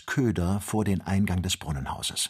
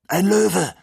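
high, loud alarm cry; calling out; 12 semitones higher, 8 dB louder than usual. A narrator's reading. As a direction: cry out a sudden warning. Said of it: "rief Joe". (lauter, hoher Warnruf)

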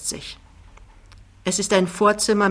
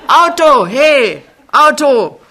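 lautes, hohes Rufen nach dem Taxi; Gebrüll; 8 semitones higher, 11 dB louder, slower than usual. Acted. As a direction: ruf das Auto laut herbei. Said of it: "brüllt der Riese plötzlich". (Jachmann brüllt plötzlich nach einem Auto)

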